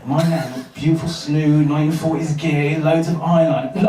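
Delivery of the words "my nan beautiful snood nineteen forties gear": ordinary delivery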